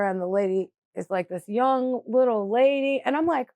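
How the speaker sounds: ordinary talk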